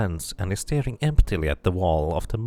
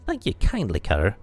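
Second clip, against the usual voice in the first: cadge coins like a sly street beggar; lightly voiced wheedling beggar; 6 semitones higher than usual. Beggar NPC thanks player.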